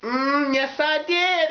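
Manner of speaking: high mock sass; sassy taunt. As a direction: lean into a smug high taunt. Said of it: "they're like".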